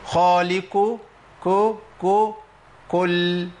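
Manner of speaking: خالق as "khaaliku", then isolated كو, كو; qaf turned kaf. Shown as a demonstration. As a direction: break down the faulty k in خالق, syllable by syllable. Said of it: "أنا ممكن أبدل القاف بكاف،".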